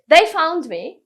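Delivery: proud repeat; emphatic reveal. Real, not acted. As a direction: punch They. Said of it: "I didn't find them".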